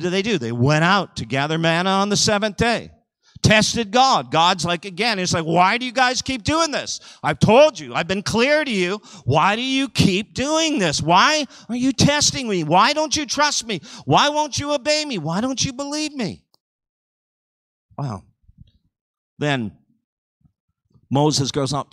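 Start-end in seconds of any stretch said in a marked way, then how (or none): 5.5-16.4 high, pleading exasperation; exasperated God. Acted. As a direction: press each question higher, as an aggrieved God. Acts out God's exasperated questioning.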